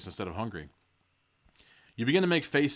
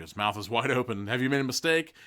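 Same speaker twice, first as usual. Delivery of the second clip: ordinary delivery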